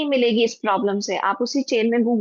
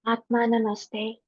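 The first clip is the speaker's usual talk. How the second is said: softer Atma Namaste, said humbly; very gentle; 5 dB quieter, slower than usual. Acted. Meditation leader saluting all souls, soft and gentle. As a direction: let Atma Namaste fall softly. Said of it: "very gently say".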